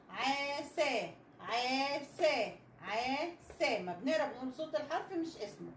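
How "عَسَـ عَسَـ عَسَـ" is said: عسى slowly drawn out as aa-sa; slow, even aa, then sa; blending sounds. Shown as a demonstration. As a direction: sound out عسى in two long syllables. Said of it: "نقرا دي بقى مع بعض".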